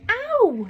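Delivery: high, loud yelp of pain. Acted. Winnie yelps as the robot pinches her nose, high and loud. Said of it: "said Winnie".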